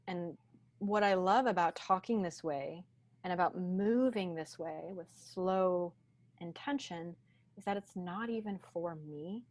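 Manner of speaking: ordinary delivery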